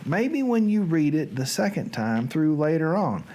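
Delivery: normal talking voice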